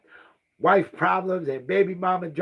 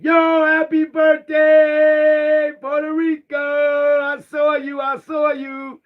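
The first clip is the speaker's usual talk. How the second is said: shout-out; 13 semitones higher, 10 dB louder, slower than usual. Real, not acted. Shouting out a friend.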